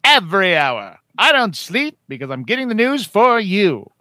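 loud booming newsreader; news announcer voice. Acted. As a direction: announce it like a hyped anchor. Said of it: "Bert Skwecky".

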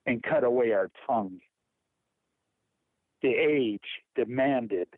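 poem reading. Acted. Reads Hemingway poem aloud.

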